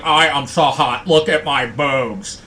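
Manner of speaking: slow mock boast, lightly put on; imitating female vlogger. Host imitates Julia showing off in her videos, slow mocking imitation. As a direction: draw it out in a mocking self-admiring voice. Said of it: "She's sitting there like".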